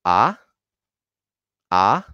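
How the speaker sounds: "Ah" rising steeply; happy tone two. Shown as a demonstration. Teacher reviews tone two for learners, happy rising "Ah". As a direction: lift "Ah" like a happy question. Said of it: "the happy tone".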